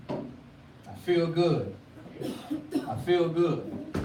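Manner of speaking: slow, dreamy put-on contentment; mock emoji voice. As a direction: drawl it like a smug emoji. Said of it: "that's the emoji".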